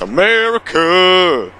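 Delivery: high, loud, drawn-out mocking cry; hammed-up patriotic shout; mock America. Mocking exclamation after reading line.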